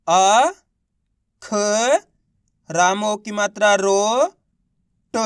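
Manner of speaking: अ, ख, रो, ट each held long and set apart; spelling out syllables. Shown as a demonstration. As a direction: sound out अ, ख, रो, ट slowly with pauses between. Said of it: "एक एक अक्षर का उच्चारण". (अ, ख, रो, ट को अलग-अलग खींचकर बोलना)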